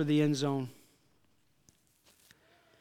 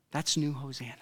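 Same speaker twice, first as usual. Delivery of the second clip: ordinary talk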